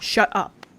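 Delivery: ordinary talk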